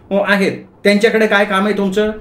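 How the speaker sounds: high, quick questioning wife; wife on the phone. Acted. Wife asked about the shop owner, high quick voice. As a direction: answer quickly as a suspicious wife.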